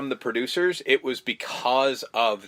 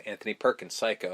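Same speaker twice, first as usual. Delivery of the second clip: low aside; 5 semitones lower, 8 dB quieter than usual. Retold or imitated. Acted film quote, evil reveal.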